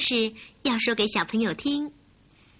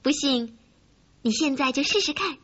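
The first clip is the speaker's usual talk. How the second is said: usual speaking voice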